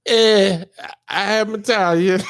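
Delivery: Italian accent. Shown as a demonstration. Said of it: "Okay, now do Italian".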